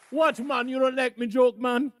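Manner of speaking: loud, high, hammed-up Jamaican accent; mock protest in a Jamaican accent. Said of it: "What do you call a Jamaican bagel".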